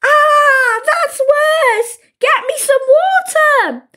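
high, anguished yell; panicked wailing. A narrator's reading. Willy with burning mouth needing water, panicked. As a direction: wail desperately for help.

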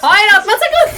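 laughing plea. Real, not acted. Begging not to be thrown.

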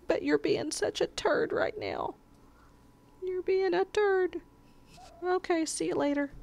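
put-on baby talk scolding the cat as a turd; high pet voice, mock scold; high cutesy scolding. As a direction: tease the cat in a high sing-song.

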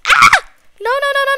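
high, loud shriek into rapid no's; panicked scream. Pretend. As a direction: scream and protest frantically. Monster attacks the player.

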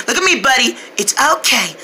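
ordinary talk

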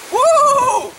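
loud, high-pitched woo; high whoop. Pretend. Cheering the horse on, high whoop.

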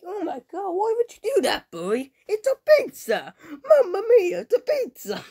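high, loud mock Italian wail; jolly italian pizza chef. Upset over wasted pizza.

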